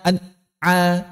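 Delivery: "an'a" with the nasal hum cut away; gunnah hilang. Shown as a demonstration. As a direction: chop the n of "an'a" short, then the ain. ("an'a" tanpa dengung pada nun)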